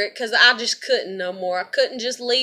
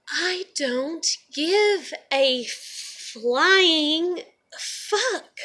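very slow defiant; 5 semitones higher, much slower than usual. Real, not acted. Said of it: "It's rude. It's hateful".

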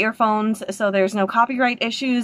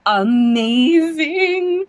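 higher, louder, very slow drawn-out word; thrilled; 7 semitones higher, 4 dB louder, much slower than usual. Real, not acted. Reacting to trailer.

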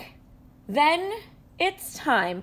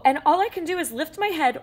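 plain reading voice

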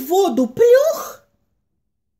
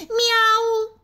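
loud meow; 11 semitones higher than usual. Acted. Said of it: "кто-то громко сказал".

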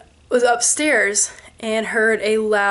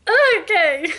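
high, laughing okay; amused okay through a laugh; 9 semitones higher than usual. Real, not acted. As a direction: laugh it out in disbelief. Family reacts laughing.

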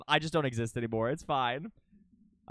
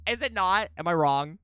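ordinary talk, nothing marked

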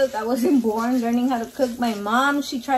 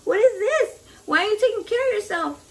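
upset mom; 9 semitones higher than usual. Retold or imitated. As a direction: play the mother scolding her daughter, pitched up high. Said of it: "She's like".

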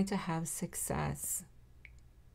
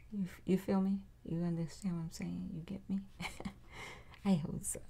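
intimate whisper aside; 5 dB quieter, faster than usual. Real, not acted. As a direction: drop to a soft whisper, confiding.

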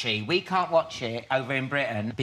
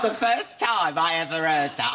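normal talking voice

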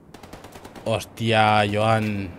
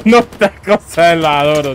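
laughing; 6 semitones higher, 9 dB louder than usual. Real, not acted. Laughs after the tense moment.

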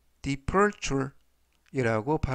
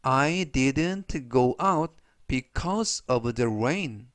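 very slow, clear sentence read for repeating; slow model reading; much slower than usual. Shown as a demonstration. Teacher reading slowly for students to repeat. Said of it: "제가 역시 천천히 세 번씩 읽어드리고요".

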